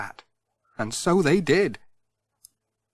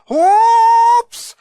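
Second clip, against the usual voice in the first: whoops; 11 dB louder than usual. A narrator's reading. Parcel slips, dramatic reading.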